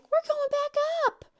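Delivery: put-on very high excited child voice; gleeful kid. Daughter wanting another go on zip lines, gleefully excited. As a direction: announce it high and eager like a kid.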